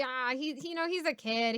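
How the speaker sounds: usual speaking voice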